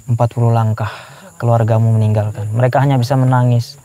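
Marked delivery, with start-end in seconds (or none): none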